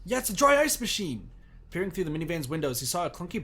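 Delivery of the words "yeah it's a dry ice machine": high, loud and triumphant; excited confirmation. Real, not acted. Player sees the dry ice guess confirmed, excited shout. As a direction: exclaim it as your guess comes true.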